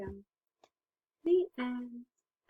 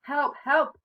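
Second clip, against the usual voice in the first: loud, raised cry for help; big pretend call for help; bellowed; 6 semitones higher, 9 dB louder than usual. A narrator's reading. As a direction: bellow it as a storybook bear in mock panic. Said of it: "bellowed Bear".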